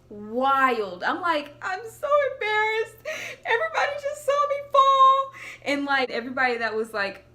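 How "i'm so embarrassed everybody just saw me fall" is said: high, loud, sing-song mock crying; mock wail of embarrassment. Her inner voice after falling in front of the crowd, high mock wailing. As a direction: wail it like a kid who just fell in public. Fell during the marching show.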